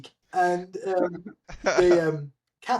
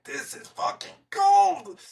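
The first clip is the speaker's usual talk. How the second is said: high, slow, strained; cold shock; 13 semitones higher, slower than usual. Retold or imitated. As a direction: squeeze it out through the cold. Gasping in icy water.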